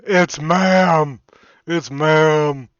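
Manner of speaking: loud mock yell, imitating the demand; mock shout. Imitating the influencer yelling at wait staff, loud. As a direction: bellow it like an angry bull. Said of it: "Dude, you sound like a bull".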